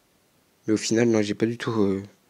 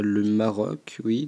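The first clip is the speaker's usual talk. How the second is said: normal talking voice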